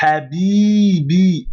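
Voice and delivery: habibi with the final i stretched very long; long i held. Shown as a demonstration. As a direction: habibi slowed right down, holding the i. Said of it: "Ça indique qu'il faut prolonger le i". (habibi, le i final bien prolongé)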